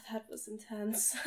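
winded, whispering on the breath; barely voiced, catching breath; breathless whisper. Real, not acted. Breathless after being choked.

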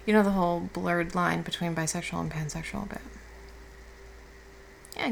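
normal talking voice

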